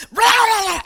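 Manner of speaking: loud, high "rah" outburst; comic roar of frustration; mock angry roar. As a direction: roar it out like someone unleashing. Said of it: "and I just unleash".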